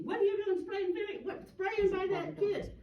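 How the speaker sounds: high-pitched retold yell; mock yelling. Board men yelling at her over vinegar, high mock yell. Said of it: "they were yelling at me".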